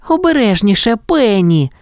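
high, mildly startled warning; alarmed cry. A narrator's reading. Anita warning Penny as an egg breaks, alarmed cry. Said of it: "скрикнула Аніта".

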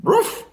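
high-pitched little dog yap; sharp, squeaky ruff; dog bark. A narrator's reading. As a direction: bark like an excited small dog. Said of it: "said Ebb".

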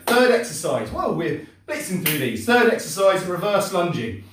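ordinary talk

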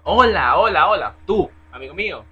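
hola, hola a todo pulmón; saludo a gritos. Real, not acted. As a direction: llamar la atención de un desconocido a gritos. Llamando a un desconocido. (saludo gritado para que alguien le haga caso)

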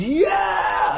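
high shouted whoop; crowd cheer. Retold or imitated. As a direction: cheer like a crowd going nuts. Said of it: "The audience goes".

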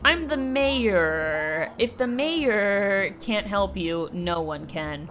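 slow, drawn-out pompous delivery; self-important mayor. Acted. Mayor to someone asking for help, slow and self-important. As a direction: stretch it out grandly, sinking at the end.

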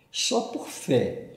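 normal talking voice

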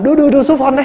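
self-mocking eager monk; mock eager hero. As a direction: play the overeager rescuer.

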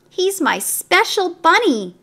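put-on little girl. A narrator's reading. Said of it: "said the little girl".

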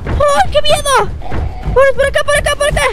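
panicked high yelling while fleeing; being chased. Real, not acted. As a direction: scream and run, terrified. Fleeing a monster, yelling "corre.

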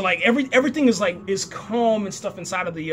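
ordinary talk

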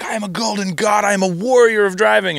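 grand, high-pitched bragging; puffed up like a champion; boastful. Retold or imitated. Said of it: "they're like".

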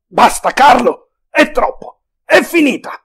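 a man at the end of his rope; desperate outburst. A narrator's reading. Soldier breaks down in despair.

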